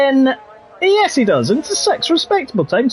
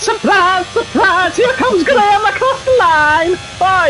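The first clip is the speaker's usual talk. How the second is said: much higher, sing-song hostess voice; 13 semitones higher than usual. Retold or imitated. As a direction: surprise surprise like a Saturday night TV hostess. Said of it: "it's only literally the surprise, surprise".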